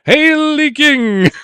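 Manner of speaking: high, slow, drawn-out herald's cry of Haley King; royal proclamation. Pretend. Quiz host punning on a player name, proclaiming like a royal herald. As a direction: proclaim it like hailing a monarch. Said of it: "Get it? Hail the King?".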